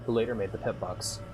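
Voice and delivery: steady, low-key audiobook reading; calm first-person story reading; story narration. A narrator's reading. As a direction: read the tale evenly to the listener. Reads fantasy story aloud.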